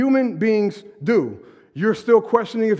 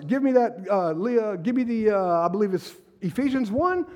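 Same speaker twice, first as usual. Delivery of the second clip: ordinary delivery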